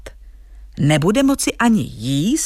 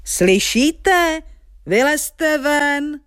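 high-pitched, stretched-out giant's holler; storybook giant's thundering summons; giant's booming call; 8 semitones higher, much slower than usual. Acted. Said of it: "jako když hrom buráci".

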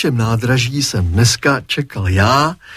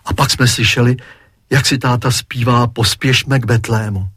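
ordinary delivery

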